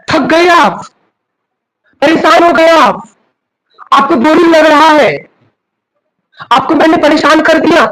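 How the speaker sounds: scolding sermon. Real, not acted. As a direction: build the rebuke line by line. Preacher scolds listeners.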